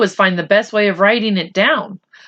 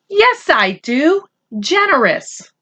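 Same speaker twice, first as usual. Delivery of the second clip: higher, slow and smug; proud retort; 8 semitones higher, slower than usual. Acted. Boy proving to his friend he knows the word, slow and pleased. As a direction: savour knowing the answer.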